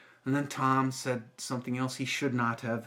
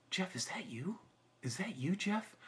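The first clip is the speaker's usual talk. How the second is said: soft, higher voice, tentatively asking; gentle recognition; 7 semitones higher, 6 dB quieter than usual. Retold or imitated. Teacher puts hand on former student's shoulder, gently questioning. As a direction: imitate her quiet, tender questioning.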